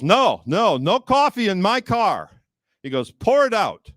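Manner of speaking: mock driver's stern order; strict driver. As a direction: bark the rule like a fussy driver. Driver forbids coffee.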